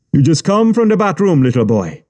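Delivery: high, jeering mock of a thug taunting a child; mocking captor. A narrator's reading. Captor taunting the wet boy, high and jeering. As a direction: sneer it down at the kid.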